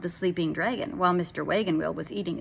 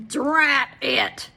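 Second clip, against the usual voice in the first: loud, higher cry of annoyance; thundering curse; 7 semitones higher, 6 dB louder than usual. A narrator's reading. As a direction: thunder it out at the rain. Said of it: "thundered Mister Wagon Wheel".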